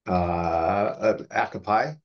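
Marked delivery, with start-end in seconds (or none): none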